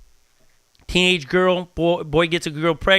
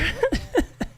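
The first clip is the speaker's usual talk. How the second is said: laugh; 4 dB quieter than usual. Real, not acted. Laughing at the memory.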